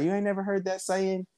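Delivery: high incredulous mock voice; the wife can't believe he never heard it, high and incredulous; quoting the wife. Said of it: "she's like".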